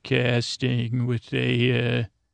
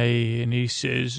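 ordinary delivery, nothing marked

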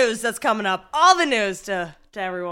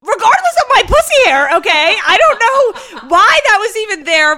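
much higher and louder indignant rant; mad; 5 semitones higher, 9 dB louder than usual. Real, not acted. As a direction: protest loudly, up high and fed up. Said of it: "She was so mad at me".

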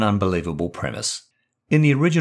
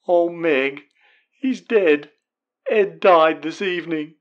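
put-on tearful young woman's voice, much higher; sobbing; 8 semitones higher, slower than usual. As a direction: break the news of his death through tears. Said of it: "There is more sobbing".

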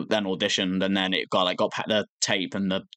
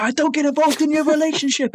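very high imitated yell; hyped-up mock anger; 4 dB louder than usual. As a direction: imitate someone losing it, voice shooting up. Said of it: "so hyped up that eventually the anger".

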